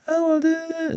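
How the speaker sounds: mocking high whine; whiny high voice. Commentator mocks the vice president's dodging, whiny high voice. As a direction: squeak it out, dodging like she's caught. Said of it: "talk a little higher pitch".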